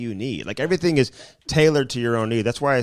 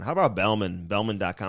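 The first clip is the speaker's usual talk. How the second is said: normal talking voice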